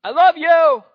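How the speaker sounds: full-voiced, very loud high shout. God shouting his love to humanity, full shout. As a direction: shout it across eternity. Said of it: "It's like he shouted".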